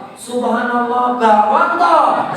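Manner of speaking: slow, stretched-out comic realisation; acted surprise, climbing high at the end; mock astonishment. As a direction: draw it out as the let-down guest. Guests discover it is only bakwan.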